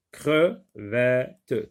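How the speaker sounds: "crevette" very slowly as cre-vè-te; three separate beats on "crevette", with gaps between; syllable by syllable. Shown as a demonstration. Teacher showing pupils three syllables, "crevette" chopped into cre-vè-te. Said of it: "trois syllabes".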